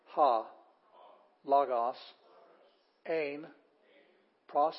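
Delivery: one word at a time, long gaps between; deliberate word-by-word Greek for a class to follow; teacher modelling each Greek word separately, firmly; reading Greek aloud. Shown as a demonstration. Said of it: "let's read Greek".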